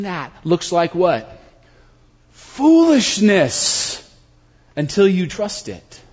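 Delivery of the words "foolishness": stretched long and high; slow, high, savour the word "Foolishness"; drawn out. Acted.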